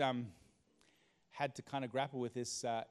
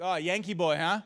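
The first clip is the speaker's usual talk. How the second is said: mocking teacher; 6 semitones higher, 11 dB louder than usual. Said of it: "He goes".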